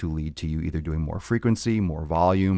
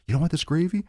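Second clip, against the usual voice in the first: much higher, pleading offer of gravy; more insistent relative; 11 semitones higher, faster than usual. Retold or imitated. Relative pressing gravy on a guest again, high pleading voice. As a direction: push harder, voice climbing high.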